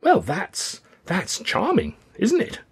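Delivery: high, hesitant, flustered youth; taken aback. A narrator's reading. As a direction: falter politely, pitch climbing. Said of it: "Young Rayner was taken aback".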